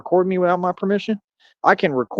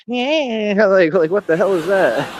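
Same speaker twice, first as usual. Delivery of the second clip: higher, laughing mock voice; mocking a heckler; 4 semitones higher, slower than usual. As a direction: laugh at the weird sound he made, voice pitched up. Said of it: "He's like".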